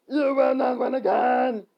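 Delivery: drawn-out high shout; yell. Acted. Skeleton challenging the hero, yelling. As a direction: yell it slow and loud. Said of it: "The one in front yelled".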